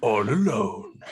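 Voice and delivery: lizard voice. Acted. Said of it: "do your best lizard impression".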